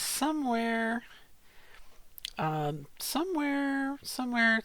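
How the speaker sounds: high, drawn-out "somewhere"; inflected, not monotone. Shown as a demonstration. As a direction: let the word float up high and stretch it. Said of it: "See, my voice is not monotone".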